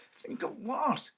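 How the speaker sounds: high and slow, bewildered; puzzled question. Acted. Husband questions his wife, high and slow. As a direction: ask it in dread. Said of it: "he questioned".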